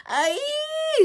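high shrieking witch scream. A narrator's reading. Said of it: "screamed the witches".

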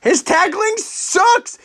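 high-pitched, drawn-out outburst; blunt exclamation. Real, not acted. Co-host jumping on a colleague's criticism of a player, high exclamation. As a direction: blurt it out high, stretching the words. Mock outrage at colleague's 'suspect' remark.

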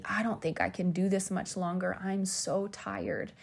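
soft, worn-out, slowing at the end; imitated weary plea; retold weak voice. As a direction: act out feeble exhaustion, drawing out the last words. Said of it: "I said really weakly".

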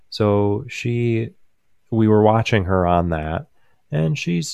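ordinary talk, nothing marked

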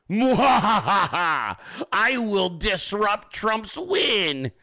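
slow evil cackle, then menacing gloat; slow theatrical villain menace. Retold or imitated. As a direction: cackle like a cartoon villain plotting. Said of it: "Liz Cheney's like".

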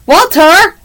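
understated storybook shout; mild shout. A narrator's reading. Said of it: "shouted Louis".

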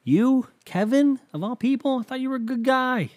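high, stunned outrage at a friend; shocked disbelief. Retold or imitated. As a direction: gasp it out, can't believe it's him. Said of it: "And you're like".

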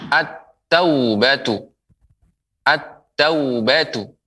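slow "at-taubatu" with a held double t; doubled t. Shown as a demonstration. Quran teacher models the letter ta, doubled t. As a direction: draw out "at-taubatu", leaning on the t. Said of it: "ditandai dengan tasydid".

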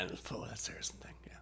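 soft unvoiced whisper. Real, not acted. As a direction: drop to a breathy whisper. Drops to a whisper.

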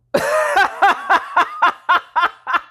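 a burst of high laughter; nervous laugh. Real, not acted. Host admits the leap scared her, nervous laugh. Laughing at how scary it was.

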